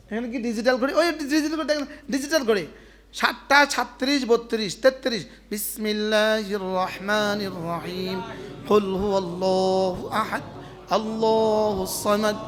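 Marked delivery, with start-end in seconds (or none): 5.5-12.4 slow, measured chanted recitation; Quran recitation. Shown as a demonstration. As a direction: recite with reverent melody. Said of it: "সর্বোচ্চ দশ second.".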